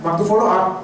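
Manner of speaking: ordinary talk, nothing marked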